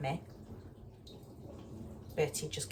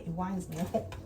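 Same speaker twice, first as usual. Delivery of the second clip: ordinary delivery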